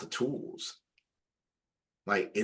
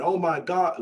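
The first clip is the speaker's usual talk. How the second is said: higher-pitched "wowed" imitation; mock amazement; 10 semitones higher than usual. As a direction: imitate someone easily impressed, pitched up. Mimics amazed users looking at output.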